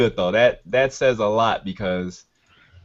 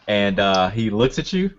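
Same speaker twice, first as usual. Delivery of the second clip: usual speaking voice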